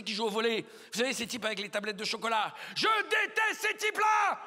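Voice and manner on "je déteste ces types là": high, strained yell of loathing; exasperated outburst. Acted. Comedian rants about musclemen in perfume adverts, exasperated. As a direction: burst out in comic outrage, high-pitched. Comic rant about muscular men.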